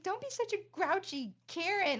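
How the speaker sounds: high, loud chipper scolding; hammed-up perky mom nagging; bossy cheerful mom. Mom urging her sulky son out of bed, high and loud. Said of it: "was like".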